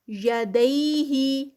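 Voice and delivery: slow, drawn-out tajweed recitation; Quranic recitation. Shown as a demonstration. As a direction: chant it slowly, stretching each sound. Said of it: "یا سے پہلے زبر ہے". (آہستہ کھینچ کر تلاوت)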